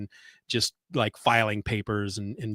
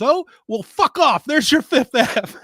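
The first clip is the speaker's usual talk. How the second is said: much higher, loud mock blow-up; imitated yelling; 12 semitones higher, 7 dB louder than usual. Spy boss dismissing the agent who corrected him, imitated yelling. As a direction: explode like an exasperated boss in a retelling. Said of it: "yelling at him at one point".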